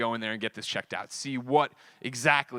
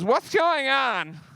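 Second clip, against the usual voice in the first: put-on girlfriend's call; 11 semitones higher, 5 dB louder, much slower than usual. Girlfriend voiced, drawn out.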